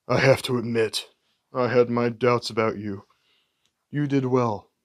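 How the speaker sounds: low, measured mercenary voice, a touch different; a hint of a calm, menacing assassin; lightly voiced cool villain; cold Deathstroke. Deathstroke lines in the script.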